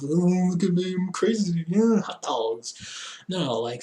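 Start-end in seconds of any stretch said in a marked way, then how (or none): 0.0-2.7 goofy put-on kids' TV wackiness; hammed-up fake craziness, higher at first; mock crazy cartoon character. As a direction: act zany, mocking it as childish. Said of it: "some Nickelodeon thing of".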